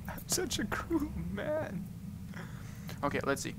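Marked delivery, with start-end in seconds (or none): 0.1-1.8 mock cruel. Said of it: "mock villain".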